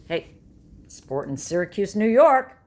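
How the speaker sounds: sarcastic, high, loud tourist-ad welcome; hammed-up welcome cheer; mock cheer. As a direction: sell the town like a cheesy ad, then cheer. Mock cheery greeting from Syracuse.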